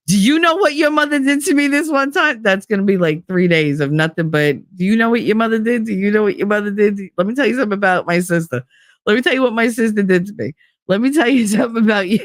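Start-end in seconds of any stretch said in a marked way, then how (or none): 0.1-2.4 high, eager mock storyteller; imitated eager tattling. Uncle telling nephew stories about his mother, mock eager. 4.8-12.2 quick, higher mock gossip; imitated relentless tattling. Uncle itching to tell on his sister, quick mock tattling. Mimicking brother's relentless storytelling.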